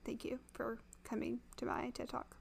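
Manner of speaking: breathy whispered sign-off. Real, not acted. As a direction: whisper it as a joke. Mock closing line.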